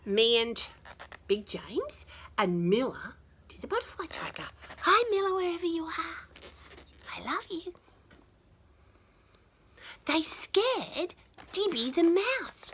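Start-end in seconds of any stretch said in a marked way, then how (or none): none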